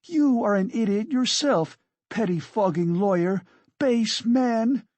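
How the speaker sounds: high-pitched indignant tirade; furious insults. Acted. Katerina hurls insults back.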